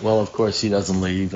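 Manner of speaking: ordinary talk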